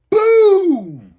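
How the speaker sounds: high, loud booming burst; loud boom imitation. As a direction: belt out the boom. Sound of door.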